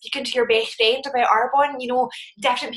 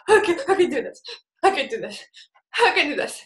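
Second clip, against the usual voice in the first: tag; 5 semitones higher, faster than usual. Retold or imitated. Tearfully repeating husband's affirmation.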